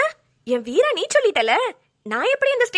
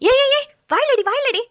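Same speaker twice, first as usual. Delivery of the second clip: higher, louder startled rebuke; shocked scolding; 8 semitones higher, 3 dB louder than usual. Acted. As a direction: cut him off, scandalised. Shocked scolding of marriage question. (அதிர்ச்சியுடன் கடிந்து)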